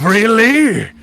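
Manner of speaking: loud, high-pitched disbelief; incredulous challenge. Acted. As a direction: throw the denial back, rising high and loud. Goliath challenges the player's denial.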